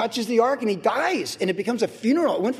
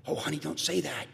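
much softer, lower wife shushing her husband; hushing wife; 4 semitones lower, 10 dB quieter than usual. Pretend. Wife quietly scolding her husband at dinner, softly hushing. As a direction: gently tell him to hush. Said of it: "conversation at the dinner table".

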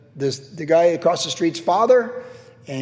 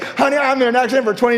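laughing mock boast; 9 semitones higher, 6 dB louder, faster than usual.

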